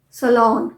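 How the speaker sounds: "सैलून" ending in an open "lon", no "oo"; correct pronunciation. Shown as a demonstration. Teacher showing how salon is said, correct pronunciation. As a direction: model "सैलून" as suh-LON. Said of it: "नू नहीं है".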